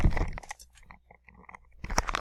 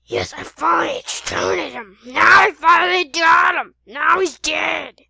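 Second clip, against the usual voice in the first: very loud, exultant yell; gloating roar; triumphant villain; 3 semitones higher, 19 dB louder than usual. Acted. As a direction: shout in triumph over the kill. Villain celebrates the kill.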